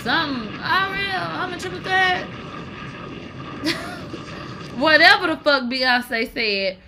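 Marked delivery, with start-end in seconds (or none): 0.0-2.2 softer, higher breathy mock of a diva brag; mocking quote. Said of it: "What she said?". 2.3-4.7 high airless giggle; silent wheeze laugh. Real, not acted. Laughing at own impression.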